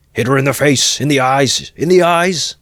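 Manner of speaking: loud, high frenzied shout; savage cry. Acted. Mikulka urging lads to beat the mare, savage cry. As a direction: scream the order. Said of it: "cried Mikulka".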